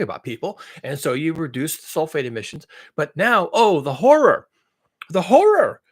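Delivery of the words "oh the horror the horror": mock horror, pitched up and loud; sarcastic horror. Mocking a climate alarm to viewers, sarcastic horror. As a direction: overplay the dismay, loudly. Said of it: "I'm being sarcastic here".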